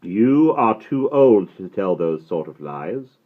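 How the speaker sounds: usual speaking voice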